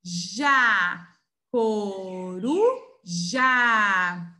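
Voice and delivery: "Coru, já" in stretched, separate syllables, "já" loud; teacher sounding out "Coru, já"; syllable-by-syllable demo. Shown as a demonstration. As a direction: break it into "Coru" and "já", holding each vowel. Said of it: "J com A".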